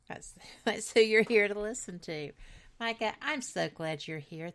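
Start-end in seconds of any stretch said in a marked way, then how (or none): 0.1-2.3 laughing through the handoff, much louder; loud laughing handoff to the guest. Real, not acted. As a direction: laugh it out as you hand over. Host laughs handing over.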